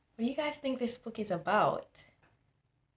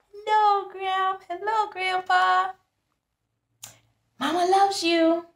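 high cheerful little llama; llama greeting voice; 13 semitones higher, 4 dB louder than usual. Acted. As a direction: squeak out a happy hello to grandparents. Characters greet in story.

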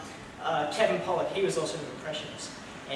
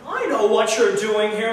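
slow, loud, higher drawling Jimmy Stewart-style voice; a loud, slow, higher-pitched aw-shucks film hero; James Stewart voice; 5 semitones higher, 11 dB louder, slower than usual. Retold or imitated. George to his rival Mister Potter, a loud slow Jimmy Stewart voice. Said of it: "an excellent actor named James Stewart".

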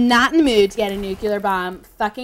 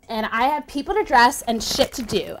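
ordinary delivery

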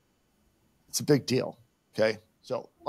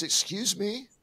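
offended retort; 8 semitones higher, slower than usual. Retold or imitated. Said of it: "And she goes".

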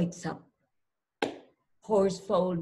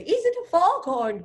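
high, louder storybook exclamation; alarmed cry; 7 semitones higher, 3 dB louder, faster than usual. A narrator's reading. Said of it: "cried Fowl".

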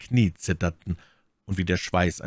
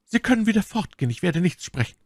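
much higher, fast brush-off; lightly voiced lady dismissing him; cold dismissal; 13 semitones higher, faster than usual. A narrator's reading. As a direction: send him away curtly.

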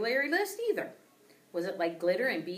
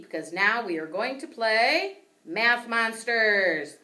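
spooky announcer; 4 semitones higher, 8 dB louder, slower than usual. A narrator's reading. Teacher announces spooky game.

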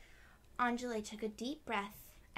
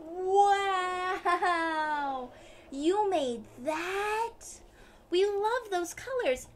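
much higher, louder amazed praise; excited wow; 7 semitones higher, 10 dB louder, much slower than usual. Acted. Classmates gushing over the license plate. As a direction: gush over it.

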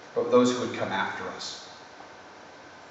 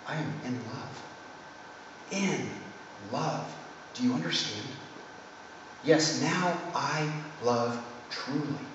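softer, serene and radiant; serene gentle lady; 2 semitones higher, 9 dB quieter than usual. A narrator's reading. The lady's gentle replies.

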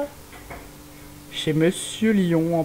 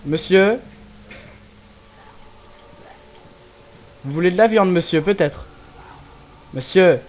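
loud, raised call to a stranger; 3 semitones higher, 6 dB louder than usual. Real, not acted. Player calls out to the game character, loud. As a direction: hail the man from a distance. Calling out to an NPC.

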